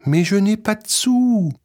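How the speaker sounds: put-on high sad child voice; a hungry little boy with no money; small boy voice. Petit bonhomme has no money.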